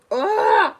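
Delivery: a sudden loud groan of annoyance at herself; exasperated groan. Real, not acted. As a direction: groan it out in frustration. Frustrated at still eating.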